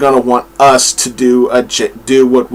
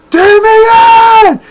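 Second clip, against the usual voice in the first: high, loud cry of the son's name; anguished shout; 5 dB louder than usual. Retold or imitated. Batman rushing to save Robin, shouting his name. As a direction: yell it like a father racing to save his son. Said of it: "He's just going".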